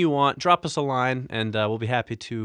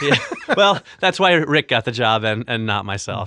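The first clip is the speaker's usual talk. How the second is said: usual speaking voice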